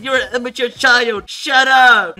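high, loud mock outburst; mad. Reading out the mad rival's insult, mock fury. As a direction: build to a loud, high retort. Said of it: "someone's a mad noob".

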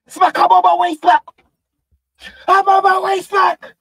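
high, loud hollering into the call; eager acted shout. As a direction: yell it out high, as if racing over. Acts out Easy answering the call.

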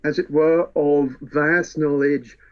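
ordinary talk, nothing marked

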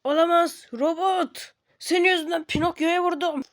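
high, loud dismay at the mistake; horrified he hit his friend. Acted. Dismay after hitting ally. (yüksek sesle dehşete düşmüş)

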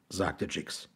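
plain reading voice

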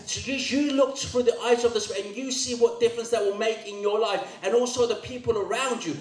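high, driving sermon voice; full-force preaching. Real, not acted. Preacher urging the congregation on the anointing, full-force preaching. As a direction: preach it urgently, pitched well up.

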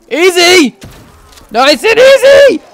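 high, loud yell of escalating panic; panicked yell. Real, not acted. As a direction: shout it as the calm gives way. Repeats easy as zombies close in.

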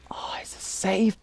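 slow hushed whisper. Real, not acted. Player finds a save point, whispering. Whispering relief in horror game.

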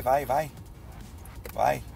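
ordinary talk

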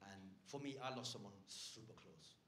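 low, hushed, grave delivery; mock-sombre confession. Comedian confiding a pandemic loss, mock-sombre and quiet. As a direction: confide a loss softly, setting up a joke. Sombre fake-out story of losing someone.

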